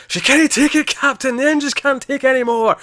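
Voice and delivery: high, loud mock Scottish engineer; over-the-top fake Scottish accent, high and loud; bad Scottish accent. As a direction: shout it like a hammy Scottish Star Trek engineer. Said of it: "his Scottish accent did leave something to be desired".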